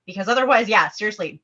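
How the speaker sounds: normal talking voice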